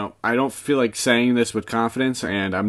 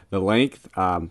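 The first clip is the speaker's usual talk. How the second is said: ordinary delivery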